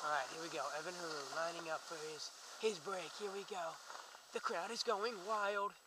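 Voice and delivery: hushed play-by-play voice; sports commentator. Acted. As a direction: call the run like a commentator.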